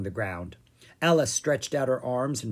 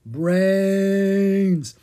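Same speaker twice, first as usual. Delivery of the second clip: loud, long, higher zombie moan; zombie groan; 6 semitones higher, 7 dB louder than usual. A narrator's reading. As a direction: groan it at the witch's door. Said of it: "Ella groaned again".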